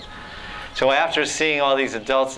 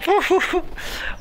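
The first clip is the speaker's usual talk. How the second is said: giggle. Retold or imitated. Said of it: "the friends would go".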